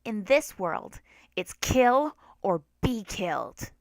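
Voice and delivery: slow, menacing villain flower; evil flower gloating over its prey; sinister flower. A narrator's reading. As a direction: drag out the threat coldly.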